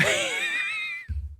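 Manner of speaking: squeaky high laugh burst; high squeal of laughter. Real, not acted. Streamer laughing at his own slip, high squeal. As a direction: break into a helpless high giggle. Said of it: "Did anyone hear that?".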